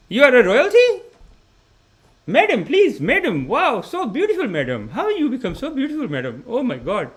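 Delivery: high, loud starstruck praise; gushing admirer. Retold or imitated. Admirer gushing at a woman, high starstruck voice. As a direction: fawn over her in awe.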